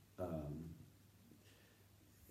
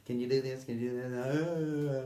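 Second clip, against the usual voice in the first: mock requests; 5 semitones higher, 5 dB louder than usual. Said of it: "Every time I turn around".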